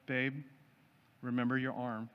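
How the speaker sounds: a quiet, tender nudge to her husband; gentle reminder. Retold or imitated. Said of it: "my loving wife will just very gently say".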